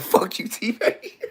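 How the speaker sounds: high retort breaking into laughter; laughing retort. Retold or imitated. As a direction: crack up while cussing out a friend.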